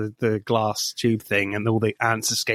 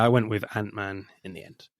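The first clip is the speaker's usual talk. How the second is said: normal talking voice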